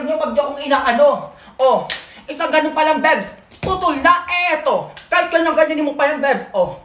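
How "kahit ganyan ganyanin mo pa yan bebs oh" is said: ordinary talk, nothing marked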